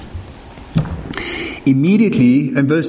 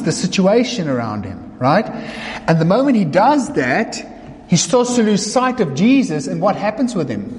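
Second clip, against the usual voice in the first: normal talking voice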